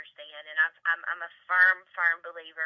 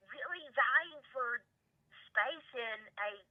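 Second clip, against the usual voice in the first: slow emphasis; 5 semitones higher, 3 dB quieter, much slower than usual. Real, not acted.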